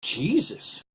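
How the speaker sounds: high, loud startled gasp. Acted. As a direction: blurt it out in shock. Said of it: "Jolly Giant Hiram gasped".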